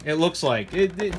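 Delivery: normal talking voice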